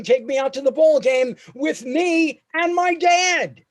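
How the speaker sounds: rising excitement, pitch up; buoyant, loud and high, slowing down. Retold or imitated. Crowd singing along.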